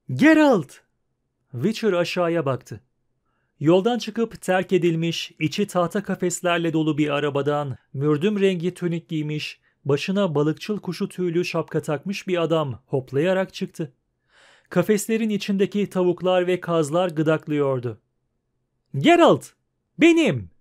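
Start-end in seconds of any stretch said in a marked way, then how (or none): 0.1-0.7 high, loud cry of a name; calling out. A narrator's reading. Man on a cart hailing Geralt, calling out. Dandelion calls to Geralt from afar. 19.0-20.5 high, loud hailing shouts; calling out. A narrator's reading. Man on a cart hailing Geralt, calling out. Dandelion hails Geralt again.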